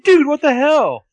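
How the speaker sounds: imitated outburst, loud, high and drawn out; outraged shout. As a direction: yell it like a wronged driver, pitch way up. Driver confronts man who misdirected him.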